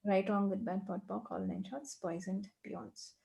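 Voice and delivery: quick, soft, murmured recital of a set formula; rote clearing formula. Acted. Coach reciting the clearing statement for the client, quick and soft. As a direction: rattle off the clearing words under your breath.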